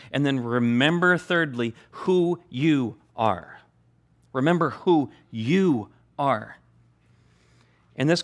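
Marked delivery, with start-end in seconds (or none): 1.9-3.6 very slow, drawn out; deliberate slow reminder; slow emphasis. Real, not acted. 4.3-6.6 slow emphasis. Real, not acted. Repeated slow reminder.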